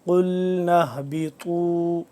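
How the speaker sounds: tajweed chant, thick emphatics in اهْبِطُوا; heavy emphatic letters. Shown as a demonstration. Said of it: "قا بھی موٹا پڑھیں گے".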